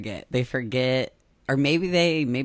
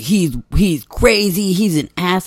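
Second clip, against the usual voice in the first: mock gossip voice; 6 semitones higher, 6 dB louder than usual. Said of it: "people were like".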